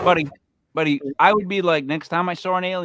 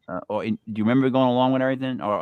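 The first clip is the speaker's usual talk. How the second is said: ordinary talk, nothing marked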